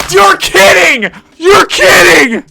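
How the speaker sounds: very high, loud, slow wailing cry; anguished wail. Real, not acted. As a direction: cry out in disbelief. Despair at a bad result.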